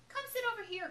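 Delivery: high friendly call across the room. A narrator's reading. Anita calling Jessica to her table, high call. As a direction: call her over warmly. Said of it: "called Anita".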